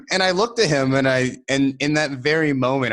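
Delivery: laughing through the words; can barely get it out for laughing; giggling mid-story. Real, not acted. Laughing while retelling.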